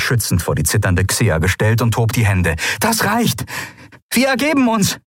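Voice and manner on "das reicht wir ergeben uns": high surrender shout; surrender call. A narrator's reading. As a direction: stop the fight by giving up. Endriel surrenders loudly.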